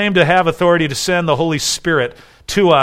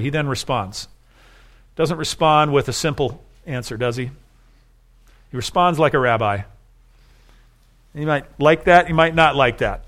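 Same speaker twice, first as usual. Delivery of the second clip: ordinary talk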